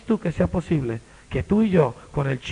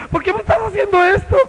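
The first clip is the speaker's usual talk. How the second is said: high, loud, indignant complaint; outraged shout; 13 semitones higher, 9 dB louder than usual. Retold or imitated. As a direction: shout at the rude stranger. (el ciego indignado grita a Jesús)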